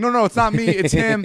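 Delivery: high, frantic mock denial; panicked protest. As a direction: imitate a wrongly accused kid. Kid wrongly accused by the cop.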